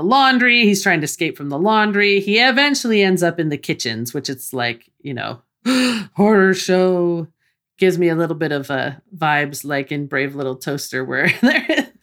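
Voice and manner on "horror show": mock horrified gasp. Mock horror about kitchen.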